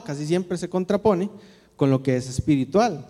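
ordinary talk, nothing marked

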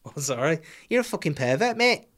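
rising high voice to a squeak at the end; mock startled creep. Startled pool creep answering in a rising voice. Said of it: "And then they'll go".

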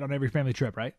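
normal talking voice

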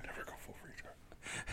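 very soft echoing whisper; whispered quote. Retold or imitated. Echoing a friend's Tropic Thunder quote, whisper. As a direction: breathe it back almost silently.

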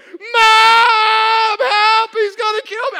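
put-on high, very loud kid's cry for mom; full-out acted panicked yell; yelling for help. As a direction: scream for rescue while fleeing. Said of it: "I'm yelling".

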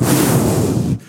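loud breathless inhale; big gasp. Pretend. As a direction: act out a deep life-giving breath. God breathing life into Adam.

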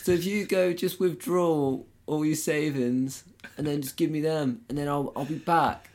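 high, loud cheeky Essex lad; a chancer talking you round, Essex; essex accent. Retold or imitated.

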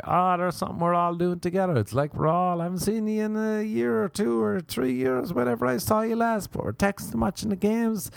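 high, mock-sentimental gush. Comic telling his Irish family how together they all feel, gushing high. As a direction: gush like a soppy family reunion.

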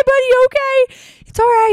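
high-pitched mock soothing; placating. Girl calming a crying guy, high placating voice. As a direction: humor him like a small child.

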